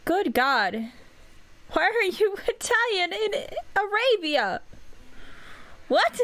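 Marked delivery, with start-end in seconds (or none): 1.7-6.3 high-pitched, incredulous laugh through the words; laughing disbelief. Real, not acted. Player baffled by Italian in Arabia, laughing disbelief. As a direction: ask it while cracking up.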